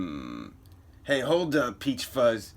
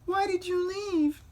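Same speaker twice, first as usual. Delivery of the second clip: put-on much higher young girl's voice. Lori asking her brother why he left, high girl voice. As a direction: ask it like a hurt little girl. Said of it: "That was the girl voice".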